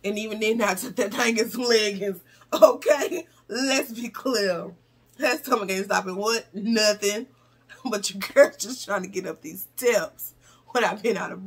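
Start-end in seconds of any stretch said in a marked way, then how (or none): 3.5-7.3 slow, drawn-out for emphasis; slow emphatic. Acted. She repeats her stomach joke slowly for emphasis. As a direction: spell it out slowly to the viewers.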